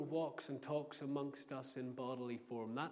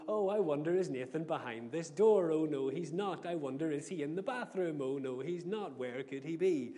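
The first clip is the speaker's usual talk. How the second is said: loud, showy pretend puzzlement; exaggerated mock searching; 7 dB louder than usual. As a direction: make a big show of hunting for a hiding child. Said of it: "I have to make a big show of it".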